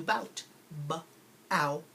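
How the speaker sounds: bout then b-out stretched apart; stretched syllable. Shown as a demonstration. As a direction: pull bout apart slowly. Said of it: "we'll stretch out the two syllables".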